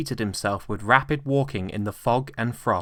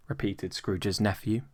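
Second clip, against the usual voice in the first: plain reading voice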